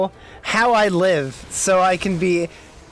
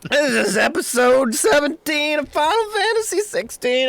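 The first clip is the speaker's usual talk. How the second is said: hammed-up intro; 9 semitones higher than usual. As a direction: belt the welcome like a host.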